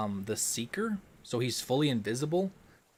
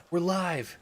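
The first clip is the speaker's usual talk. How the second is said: higher, punchy streamer catchphrase; higher-pitched hype chant to the stream; hype call-out; 5 semitones higher, much slower than usual. Acted. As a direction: announce it like a hype intro.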